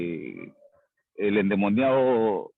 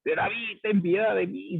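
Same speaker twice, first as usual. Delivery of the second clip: much higher pitch, imitating a cry without added volume; retold plea, pitched up high; mock pleading cry; 9 semitones higher than usual. Blind man keeps calling to Jesus for mercy, mock pleading cry. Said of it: "él seguía gritando".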